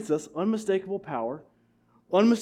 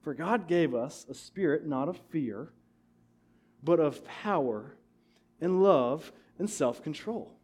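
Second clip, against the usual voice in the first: slow, soft scripture reading; slowly, weighing each phrase; slow deliberate scripture reading; slow emphatic reading; 3 semitones lower, 5 dB quieter than usual. Shown as a demonstration. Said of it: "I'm gonna say it again".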